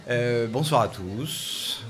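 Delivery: drawn-out, slow bonsoir with a rising end; slow mic-test greeting. Shown as a demonstration. Speaker testing the microphone for the back of the room, slow drawn-out greeting. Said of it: "Tout le monde entend au fond".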